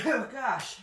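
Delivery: catching breath after a sneeze; recovering from a sneeze; sneezing. Real, not acted. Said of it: "and I'm gonna sneeze here".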